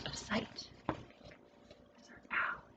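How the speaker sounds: whisper. Real, not acted. Whispered aside pointing at pictures.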